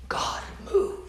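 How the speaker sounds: breathless whispered plea; whispered prayer. Acted. Prophet whispering his last plea to God. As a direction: barely breathe the words.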